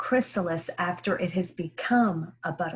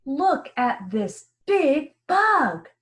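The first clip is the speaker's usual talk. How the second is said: higher, louder and slow, full of excitement; excited exclamation; 6 semitones higher, 5 dB louder, slower than usual. Shown as a demonstration. Teacher reading to young pupils, excited and slow. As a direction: read it with real excitement for the class. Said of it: "Let me read it again with the exclamation point".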